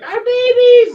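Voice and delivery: high, loud outcry; panicked shriek. Acted. Mushroom parents to the one taking their young, panicked shriek. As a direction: cry out in high-pitched alarm. Mushroom folk freaking out at player.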